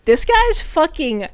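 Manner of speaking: mock awe. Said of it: "It was more like".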